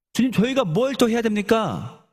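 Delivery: high-pitched acted appeal; exasperated question. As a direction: plead for an answer as a frustrated follower. Acted crowd questioning Jesus.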